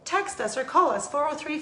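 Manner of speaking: ordinary talk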